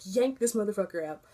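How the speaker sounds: ordinary talk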